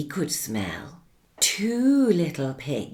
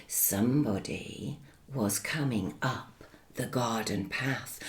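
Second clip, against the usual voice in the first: hushed suspense; 6 semitones lower, 8 dB quieter than usual. A narrator's reading. Suspense, wolf approaching.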